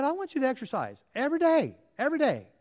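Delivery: mildly imitating the doctor's orders, quick; insistent cardiologist. Retelling his cardiologist's orders, lightly voiced insistent doctor. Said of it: "He said".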